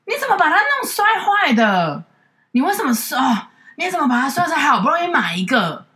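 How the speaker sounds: high, scolding mother voice, slowed; nagging mom. Retold or imitated. Daughter mimics mom scolding her over the broken glass lid, high nagging voice. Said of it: "于是我妈的反应是".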